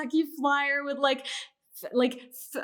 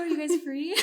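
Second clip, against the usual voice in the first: normal talking voice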